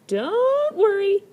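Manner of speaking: very high, loud, slow drawn-out cry; cried out reassuringly. A narrator's reading. Fourth brother comforts seventh brother, high bold cry. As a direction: reassure the little brother boldly. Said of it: "cried fourth brother".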